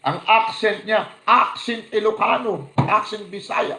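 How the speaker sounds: slow, high, loud mockery; scornful preacher mocking a celebrity pastor. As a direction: ridicule the pastor's showy talk.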